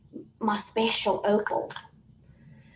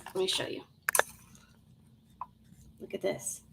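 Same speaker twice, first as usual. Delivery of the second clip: whisper; 3 semitones lower, 13 dB quieter, faster than usual. Real, not acted. Hushed showing the stone.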